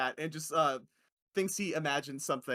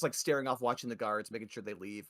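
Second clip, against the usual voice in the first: ordinary talk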